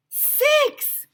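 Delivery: high loud shout; kids shouting. Acted. Class shouting answer to the clown, high loud shout. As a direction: yell the answer like eager kids. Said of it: "we all shouted".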